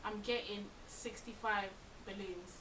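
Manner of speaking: ordinary delivery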